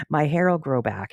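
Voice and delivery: a hint of a small girl being grown-up about it; little girl voice. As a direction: play the kid calmly reassuring her mother. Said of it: "she keeps trying to convince the mom".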